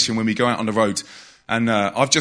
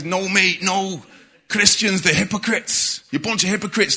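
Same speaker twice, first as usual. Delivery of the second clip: put-on Mancunian accent; 5 semitones higher than usual. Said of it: "He's Mancunian".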